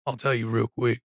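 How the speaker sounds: normal talking voice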